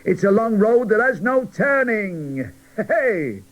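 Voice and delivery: cheery cracker greeting trapped dolls; bright breezy voice. Acted. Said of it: "a bright, breezy voice say".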